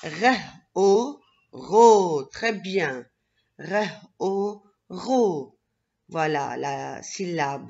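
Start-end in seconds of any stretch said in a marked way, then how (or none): 0.0-2.2 loud "Re", then "o", then blended "ro"; teacher loudly blending "Re, o" into "ro"; sounding out. Shown as a demonstration. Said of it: "continuons ensemble l'association". 3.6-5.6 "Re" and "o" apart, then "ro"; teacher blending "Re, o" into "ro"; sounding out. Shown as a demonstration. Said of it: "continuons ensemble l'association".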